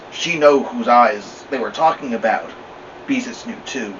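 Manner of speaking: ordinary delivery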